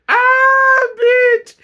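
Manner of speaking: high loud squeal through laughter; laughing shout. Real, not acted. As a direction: squeal it, doubled over laughing. Cracking up at the text.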